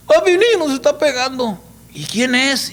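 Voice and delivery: put-on high, hurried kids running to dad; frightened boys crying out to their father; scared little boys. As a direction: voice the frightened small sons. Scared little boys voiced in story.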